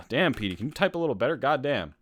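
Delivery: ordinary delivery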